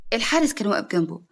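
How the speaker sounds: plain reading voice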